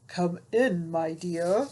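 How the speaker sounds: wolf faking Granny, lightly gruff; a hint of a rough wolf voice; deep scratchy voice. Wolf pretending to be Granny greeting Little Red. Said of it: "a deep, scratchy voice that answered".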